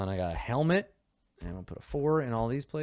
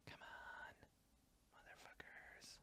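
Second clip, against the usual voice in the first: much softer, breathy frustrated whisper under the breath; whispered curse; 35 dB quieter than usual. Real, not acted. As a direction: hiss it quietly at the screen. Frustrated at game menu.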